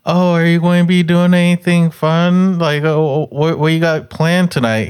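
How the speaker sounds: mock friendly cashier voice, pitched high; high chirpy barista. Retelling the worker's small talk in a high mock voice. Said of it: "homegirl was like".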